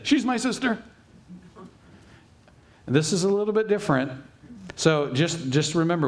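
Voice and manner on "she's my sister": higher, put-on voice for Isaac's lie; quick sly answer from Isaac; lightly voiced sly husband.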